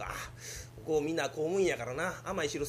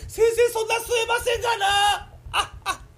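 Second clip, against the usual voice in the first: much higher, strained protest; strained shout; 8 dB louder than usual. Acted. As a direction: yell it like your lungs are full. Patient protests, out of air.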